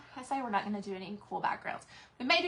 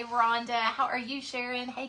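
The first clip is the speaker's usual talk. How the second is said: usual speaking voice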